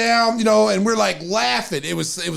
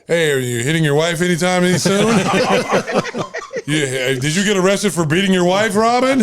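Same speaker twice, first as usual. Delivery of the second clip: teasing through laughter. Retold or imitated. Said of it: "So Phil goes".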